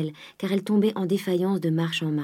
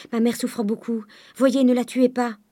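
raised, pleading daughter's voice; higher, imploring, growing louder; urgent plea; 6 semitones higher, 4 dB louder than usual. A narrator's reading. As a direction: beg him to spare her. Daughter pleads for her mother.

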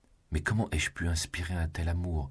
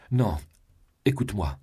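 higher and louder, begging him to stay; crying out; 6 semitones higher, 5 dB louder than usual. A narrator's reading. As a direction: call after him from her knees, pleading. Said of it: "s'écria sa femme à genoux". (s'écrie plus haut et plus fort, suppliante)

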